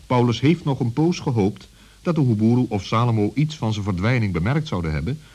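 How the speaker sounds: usual speaking voice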